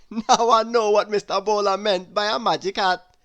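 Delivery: chuckling postman. Acted. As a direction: laugh it off at the joke. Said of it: "chuckled the postman".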